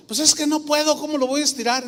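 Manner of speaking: lightly voiced humble old man objecting; protesting grandfather. Grandfather telling the woman he cannot stretch it, plaintive protest.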